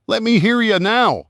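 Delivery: high, carefree sing-along shout; sudden shout. Acted. Driver lost in the music shouting to the van. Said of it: "he suddenly shouted".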